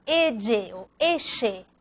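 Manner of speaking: "E" voiced as "edye", "eche" hushed as "eshe"; other LL variants. Shown as a demonstration. Teacher listing other regional names of the letter, variant LL sounds. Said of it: "Hay otras también".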